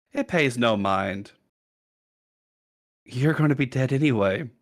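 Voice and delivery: villain taunts the helpless hero, mockingly; taunting villain. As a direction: needle him before the kill. Villain taunts paralyzed Drill.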